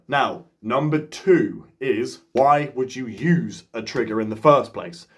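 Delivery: usual speaking voice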